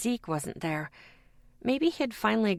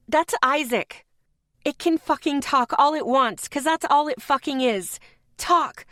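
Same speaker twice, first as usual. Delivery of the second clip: yelling; 7 semitones higher, 4 dB louder than usual. A narrator's reading. Heated quarrel, narrator yelling.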